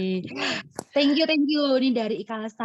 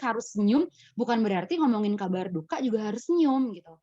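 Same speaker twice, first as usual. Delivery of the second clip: usual speaking voice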